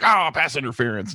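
mock outraged cry at the refs; indignant fan. Biased fan watching the game blames the refs, mock indignant. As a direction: exclaim in protest like a biased fan. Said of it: "and I'm like".

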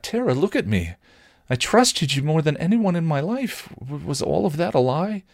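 high-pitched, hurt pleading; insistent plea. A narrator's reading. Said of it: "Willow refused to leave it at that".

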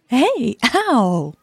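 mock ow. Pinched on the hand.

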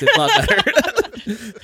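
high, slow laughing mock Aussie; Australian accent. Joking along in an Australian accent. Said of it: "Australian accent".

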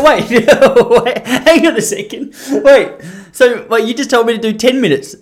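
loud, high, laughing protest; flustered protest. Retold or imitated. Podcaster retells objecting to his friend's advice, loud laughing disbelief. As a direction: imitate yourself objecting, laughter breaking in.

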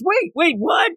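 high startled double take; surprised. Retold or imitated. Girl realizing she's being served, high and startled. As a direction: catch yourself, high and startled. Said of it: "And then goes".

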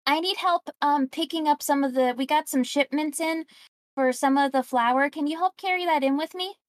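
a cheerful merchant asking the customer a favour. Acted.

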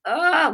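high mock-crying groan; imitated groan. Preacher retelling her groaning in prayer, high crying groan. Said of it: "groaning, like, like".

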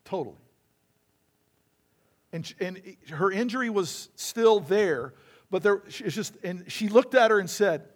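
normal talking voice

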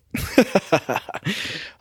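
short burst of laughter. Real, not acted. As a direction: laugh at your own bit. Laughs after reading the description.

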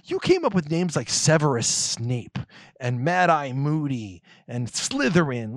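ordinary talk

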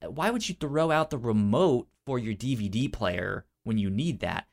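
ordinary talk

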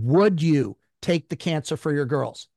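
slow, deliberate, commanding retold voice; defiant, authoritative. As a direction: imitate an inner voice insisting with authority, drawn out. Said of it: "a more defiant, authoritative tone".

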